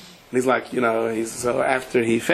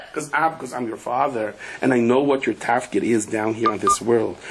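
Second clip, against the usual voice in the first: normal talking voice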